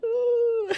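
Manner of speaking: high swooping ooh of feeling, said in fun; emotional ooh. Retold or imitated. While driving to the gym, a song hits, high ooh. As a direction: let out a sudden high wavering ooh. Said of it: "songs come on and I'm like".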